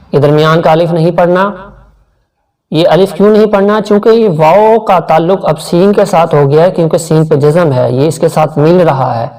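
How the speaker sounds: normal talking voice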